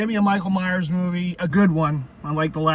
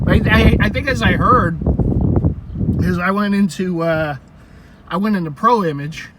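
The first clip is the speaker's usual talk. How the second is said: loud; 3 semitones higher, 10 dB louder than usual. Real, not acted. Sudden loud retelling.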